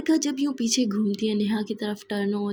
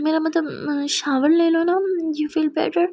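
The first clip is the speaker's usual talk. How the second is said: flustered backtrack; 5 semitones higher, 5 dB louder, slower than usual. Acted. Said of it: "बरखा बोलती".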